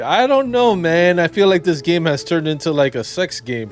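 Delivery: high, loud protest, easing down toward the end; loud exasperated reaction. Real, not acted. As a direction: burst out, flustered by the scene. Flustered reaction after racy scene.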